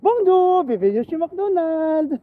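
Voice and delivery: slow, high French greeting with Ilonggo sounds; Ilonggo-accented French. Retold or imitated. Vlogger trying the greeting Ilonggo style, Ilonggo-accented French. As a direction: greet like an Ilonggo cashier in France. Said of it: "tapos Ilonggo version".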